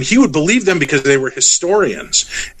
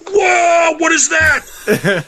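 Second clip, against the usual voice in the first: very high, drawn-out exclamation; mock startled yell; much slower than usual. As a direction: imitate a friend yelling in alarm. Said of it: "he had yelled over part of it".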